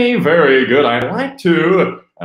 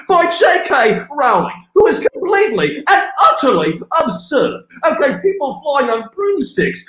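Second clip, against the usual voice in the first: an incredulous tirade, hammed up; mock rant; 11 semitones higher than usual. Ranting at the audience about Quidditch, mock outraged.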